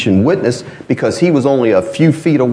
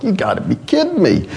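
higher-pitched mock disbelief; incredulous prosecutor; 8 semitones higher than usual. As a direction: play an attorney who can't believe it. Acted reaction of exasperated prosecutor.

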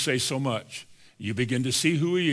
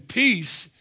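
peace lifted and louder; emphatic peace; 6 semitones higher, 4 dB louder than usual. Shown as a demonstration. Preacher showing congregation the doubled peace, stressed. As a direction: punch the word peace. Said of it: "emphasized like that".

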